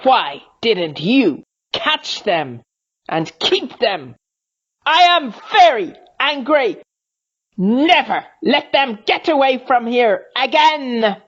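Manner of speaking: high, loud furious creature voice; furious Snagron. A narrator's reading. Snagron rages at the silent rocks, furious. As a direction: explode with anger.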